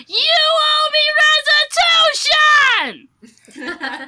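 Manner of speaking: high, shouted cartoon demand; imitating Sally. Quoting Sally for the blockhead talk, shouted imitation. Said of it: "that's why Sally says this".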